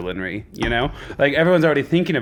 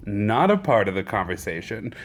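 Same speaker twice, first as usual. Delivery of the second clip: ordinary talk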